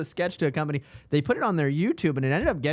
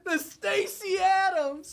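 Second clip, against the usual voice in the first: high, slow, drawn-out cry; hammed-up anguished wail; mock wail; much slower than usual. Mourning the friend's shoes, mock-crying the brand name.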